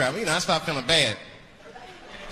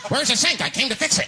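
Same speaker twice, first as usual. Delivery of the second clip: flustered excuse; 7 semitones higher than usual. Acted.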